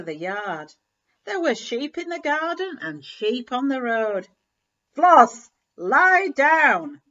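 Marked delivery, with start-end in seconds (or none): 4.9-7.0 much louder, sharp order to the dog; thundering command. Acted. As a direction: bark it out like thunder. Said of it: "The farmer's voice was like thunder".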